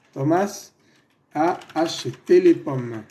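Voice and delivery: "Tomás a acheté les pommes" read slowly for students to copy, each word spaced; slow, careful classroom dictation of "acheté"; slow dictation pace. Shown as a demonstration. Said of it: "Démonos cuenta que aquí estamos usando".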